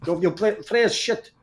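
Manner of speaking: clumsy fake Greek accent; bad Greek accent. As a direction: put on a weak Greek accent. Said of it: "worst Greek accent I've ever heard".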